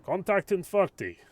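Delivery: a driver barking in German English; German driver accent. Acted. German driver calling the contact warning, German accent. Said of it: "I can't do a German accent now".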